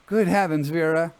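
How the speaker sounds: sudden high, loud exclamation; realising she forgot the oboes, crying out to Vera; alarmed cry. Acted. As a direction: blurt it out in alarm. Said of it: "I cried".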